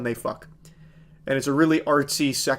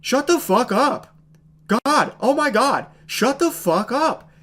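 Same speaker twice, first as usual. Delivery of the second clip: imitating a bored, slow, higher line reading; mock unenthusiastic; 9 semitones higher than usual. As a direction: parody a lifeless first-take delivery. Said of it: "in the most unenthusiastic tone ever".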